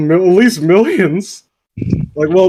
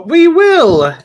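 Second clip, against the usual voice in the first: very high, loud falsetto chirp; proud sweet nymphs; 12 semitones higher, 8 dB louder than usual. Acted. Nymphs pledge help to Claus, proud high exclamation. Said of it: "exclaimed the sweet nymphs proudly".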